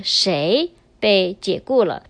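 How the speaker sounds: 谁 stressed, then a short pause; emphatic pause. Shown as a demonstration. Teacher shows learners the key word, stressing 谁 then pausing. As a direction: set 谁 apart before finishing the question. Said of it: "we normally pause a little bit".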